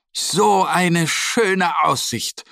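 langsam, staunend gerufen; begeistert rufend. A narrator's reading. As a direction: die Aussicht langsam bestaunen. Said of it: "rufen sie". (Freunde rufen am Gipfel staunend)

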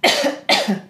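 a strong clearing cough; demo cough. Shown as a demonstration. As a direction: cough it out hard once. (kräftiges Abhusten)

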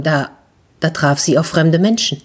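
plain reading voice

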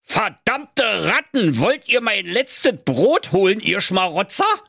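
put-on old miser cursing the rats; angry miser. A narrator's reading. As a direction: yell at the rats in the cupboard. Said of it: "riss die Türe auf und rief".

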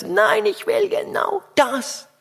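preacher imitating an upset little girl. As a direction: whine like a little girl whose toy was taken. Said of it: "sagt dann".

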